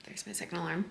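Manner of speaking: soft whisper. Real, not acted. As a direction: whisper it under your breath. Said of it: "I kind of have to whisper because Taylor's still sleeping".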